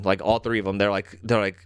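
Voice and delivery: usual speaking voice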